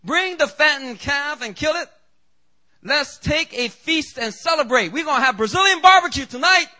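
high, loud, jubilant commands; joyful father. Acted. The father calls his servants for a feast, high and loud joy. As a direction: shout the orders like a thrilled father. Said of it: "the father said to his servants".

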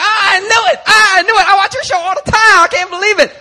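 high, loud, squealing starstruck delight; excited squeal. Retold or imitated. Preacher mimicking bystanders who spot the host, squealing excitement. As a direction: gush like a caught fan meeting the host. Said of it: "they're like".

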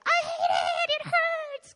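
put-on high, loud whining toddler; preacher acting out a toddler at the dentist, high whine; whiny toddler. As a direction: wail it like a two-year-old at the dentist. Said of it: "two-year-olds will be like".

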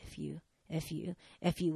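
soft, low repeated insult; muttered mock curses. Stranger cursing behind her on the stairs, quietly muttered. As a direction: mutter it lightly, imitating him, one per step.

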